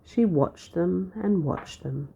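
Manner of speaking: ordinary delivery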